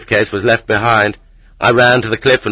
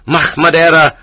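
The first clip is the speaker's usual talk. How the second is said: high, raised cry; shouting; 8 semitones higher, 4 dB louder than usual. A narrator's reading. Fugitive cries out while fighting his captors, shouting. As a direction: shout it out mid-struggle. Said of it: "I shouted".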